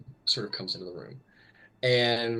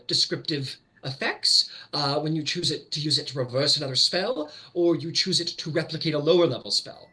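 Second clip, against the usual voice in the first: higher-pitched formal quizmaster; examining Grand Mage; 5 semitones higher than usual. Acted. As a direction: read out the options as an examiner.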